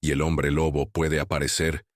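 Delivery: plain reading voice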